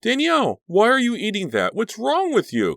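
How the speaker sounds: very high, loud alarmed cry; shrill, horrified questioning. A narrator's reading. Cliff catches Danielle eating raw meat, alarmed. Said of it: "Cliff cried in alarm".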